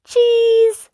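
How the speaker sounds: very high piglet voice; Peppa. A narrator's reading. Peppa handing over shopping, very high piglet. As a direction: say it like a small child, way up. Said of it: "Peppa said".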